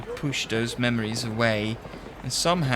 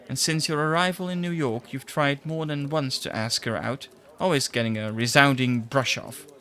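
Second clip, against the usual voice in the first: normal talking voice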